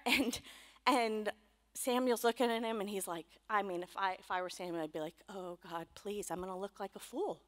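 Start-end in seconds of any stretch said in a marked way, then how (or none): 5.3-7.4 mild nervous prayer. Retold or imitated. Samuel's anxious inner prayer.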